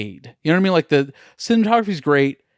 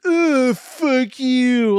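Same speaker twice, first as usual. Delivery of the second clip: high-pitched, exaggerated disgusted groan into dismissal; mock contempt; 10 semitones higher, much slower than usual. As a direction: act out a spiteful director brushing fans off. Mimicking director's contempt for fans.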